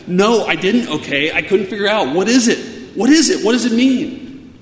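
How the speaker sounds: loud, high-pitched outburst of frustration; exasperated snapping. Retold or imitated. Grown child at wits' end with dad over the puzzle, exasperated snapping. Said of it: "I snapped at him".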